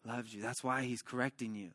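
low, soft reassurance; acted tender pastoral comfort; comforting friend. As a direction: console gently, as if holding a friend. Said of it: "He's embracing him".

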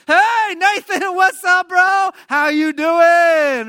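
high, loud, drawn-out hello; hammed-up buddy greeting, trying too hard to seem cool; overeager fake-casual greeting. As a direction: shout it slow and high, faking nonchalance. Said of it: "trying to be cool".

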